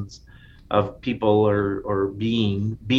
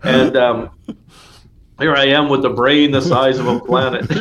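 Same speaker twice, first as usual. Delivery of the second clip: Hitchhiker's Guide robot; 5 dB louder than usual. Pretend. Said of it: "Hitchhiker's Guide to the Galaxy".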